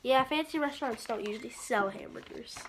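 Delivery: usual speaking voice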